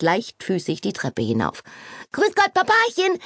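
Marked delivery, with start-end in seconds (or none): 2.1-3.2 high bright call across the hall; Kitty greets her father from the hallway, calling out high. A narrator's reading. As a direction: call it out far down the corridor. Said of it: "rief sie durch den Flur".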